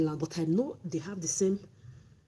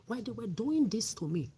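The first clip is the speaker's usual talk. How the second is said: normal talking voice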